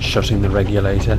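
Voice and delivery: plain reading voice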